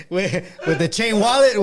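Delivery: laughing through the words. Real, not acted. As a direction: giggle while saying it. Laughing at own description.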